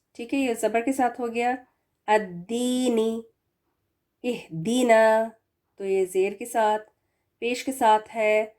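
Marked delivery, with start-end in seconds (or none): 2.1-5.3 slow, drawn Quranic recitation. Acted. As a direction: recite each word slowly and clearly.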